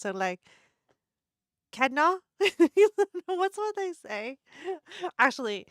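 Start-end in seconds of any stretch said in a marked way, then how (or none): 1.7-2.2 put-on a quick try at a Singaporean accent; tentative Singaporean accent, said a little louder. Vlogger starts her Merlion outfit bit in a Singaporean accent. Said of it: "I need to put on a Singaporean accent".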